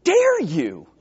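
high, mock outraged rebuke; indignant Gabriel. Gabriel rebuking Zechariah for doubting, mock indignant. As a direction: scold him with a laugh in it. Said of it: "almost like Gabriel is saying".

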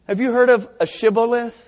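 usual speaking voice

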